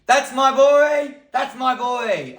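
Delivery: high, loud drawn-out cheer; slow, stretched shout of fatherly pride; proud dad cheering. Acted. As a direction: roar with pride at your son. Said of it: "And he'll go".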